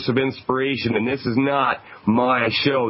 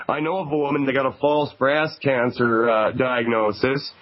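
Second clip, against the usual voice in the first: ordinary talk, nothing marked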